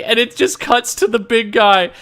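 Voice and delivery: ordinary delivery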